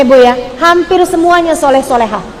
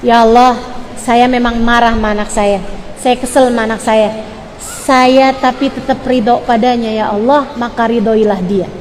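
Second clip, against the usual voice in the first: low, subdued plea to God; model prayer; 4 semitones lower, 3 dB quieter than usual. Shown as a demonstration. Teacher models a mother's prayer when angry, low and subdued. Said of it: "doanya kayak gini nih".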